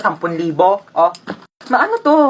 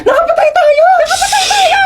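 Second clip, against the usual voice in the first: much higher, screaming and crying; hysterical shriek; 4 dB louder than usual. Acted. Realizing they hit a child, hysterical shrieking cry.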